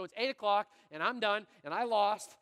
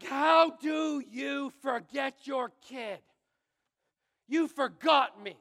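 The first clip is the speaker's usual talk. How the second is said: put-on angry kid; 2 semitones higher, much slower than usual. Boy shouting at his parents who came late, slow angry yell. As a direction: drag out every word at them. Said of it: "I'm both relieved and angry".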